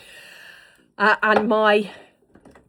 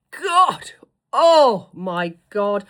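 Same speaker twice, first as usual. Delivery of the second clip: slow, drawn-out louder gush; drawn-out delighted oh my God; 9 dB louder, much slower than usual. Real, not acted. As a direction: swoon over the lipstick colour. Gushing over a lipstick.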